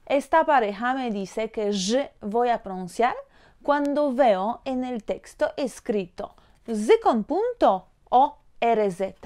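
usual speaking voice

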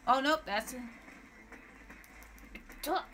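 usual speaking voice

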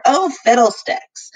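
plain reading voice

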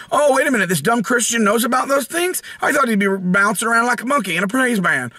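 high-pitched put-on disbelief; exaggerated high-voiced astonishment; mock surprised critic. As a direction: play a scornful critic taken aback. Mocking critics' surprise at him.

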